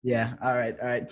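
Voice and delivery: ordinary talk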